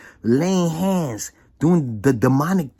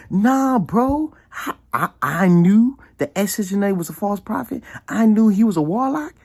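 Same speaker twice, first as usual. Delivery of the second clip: higher and louder, insistent; raised-voice retold retort; heated retort; 8 semitones higher, 3 dB louder than usual. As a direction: set the record straight. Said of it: "I said".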